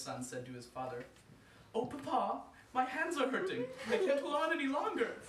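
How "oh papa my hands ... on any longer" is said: put-on high, loud child's plea; a child losing grip; little son whimpering. Youngest son to his father, pleading.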